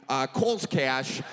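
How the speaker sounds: usual speaking voice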